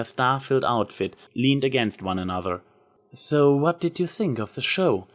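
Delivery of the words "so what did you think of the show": a touch higher, lightly voiced showmare; lightly voiced confident performer; hint of a hopeful performer; Trixie's voice. A narrator's reading.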